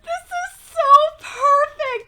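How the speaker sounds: high, loud, teary gush; overjoyed, near tears. Retold or imitated. Recalling hearing the opening song, teary gush. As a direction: gush like you might cry. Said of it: "I just was like".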